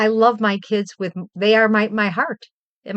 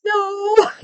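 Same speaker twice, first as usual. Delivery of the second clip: wailing no; 13 semitones higher, 3 dB louder than usual. Retold or imitated. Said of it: "She goes".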